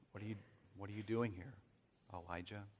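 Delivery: soft, hushed question from God; gentle question. Acted. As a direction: ask it quietly, intimately. God's quiet voice.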